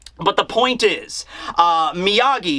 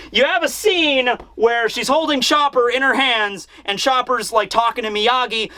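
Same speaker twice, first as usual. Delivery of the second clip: ordinary talk, nothing marked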